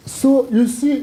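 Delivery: usual speaking voice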